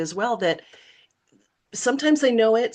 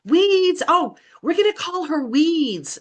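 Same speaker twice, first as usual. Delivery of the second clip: high, loud sing-out of a nickname; taunting playground call; 7 semitones higher, 4 dB louder than usual. Retold or imitated. Kids across the playground naming a fourth grader, high mocking call. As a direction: mimic kids jeering from across the yard. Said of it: "hearing this from across the playground".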